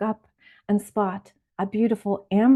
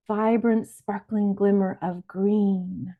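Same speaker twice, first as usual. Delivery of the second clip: ordinary talk, nothing marked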